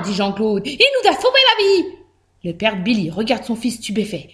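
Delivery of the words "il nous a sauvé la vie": high-pitched little protest; protesting worm. A narrator's reading. Worm defending hamster to his father, high and insistent. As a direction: speak up in a high voice.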